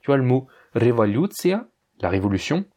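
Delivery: usual speaking voice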